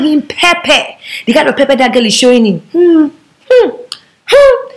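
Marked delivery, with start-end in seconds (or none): 2.7-4.7 short high-pitched "hmm" sounds, climbing higher; teasing hums. Acted. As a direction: hum pointedly, each one separate and high. Teasing hums about the other girl.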